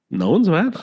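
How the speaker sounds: high, rising retold disbelief; baffled protest, pitch shooting up; incredulous. Replying to friend's excuse, retold incredulously.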